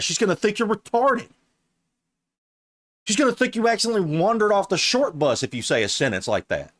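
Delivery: high-pitched, incredulous scolding; exasperated rant. Real, not acted. Reacting to the dating coach's example, exasperated.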